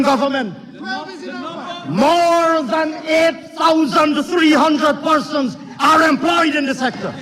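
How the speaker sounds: impassioned, declaimed political rebuke; fiery parliamentary oratory. Real, not acted. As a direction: hurl it accusingly across the chamber. Fiery attack on opposition in parliament.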